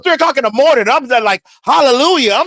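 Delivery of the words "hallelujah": high, exultant praise; mock church rejoicing. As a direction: cry it out like a moved churchgoer. Retold rejoicing at driver's testimony.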